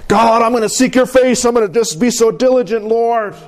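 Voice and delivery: high-pitched eager prayer; hammed-up zealous praying; earnest vow.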